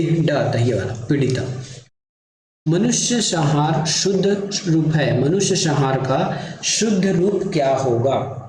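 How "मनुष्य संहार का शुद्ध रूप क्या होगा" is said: usual speaking voice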